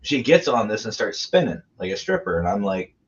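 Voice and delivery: ordinary talk, nothing marked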